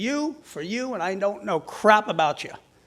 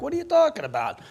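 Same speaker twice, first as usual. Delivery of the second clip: puzzled; 10 semitones higher, 3 dB louder, faster than usual. Retold or imitated. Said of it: "They're like".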